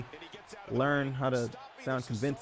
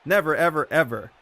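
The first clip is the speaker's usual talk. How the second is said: louder, teasing "never, ever" through a smile; grinning never ever; 3 semitones higher, 6 dB louder than usual. Retold or imitated. Retelling CM Punk's interview reply, louder, grinning. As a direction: toss it off with a playful grin. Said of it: "with a big smile on his face".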